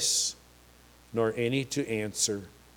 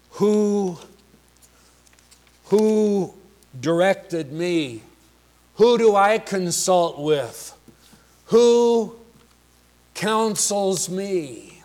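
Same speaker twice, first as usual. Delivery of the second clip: high, loud challenging questions; emphatic rhetorical questions; 7 semitones higher, 6 dB louder, slower than usual. Retold or imitated. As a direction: fling each question out like a prophet.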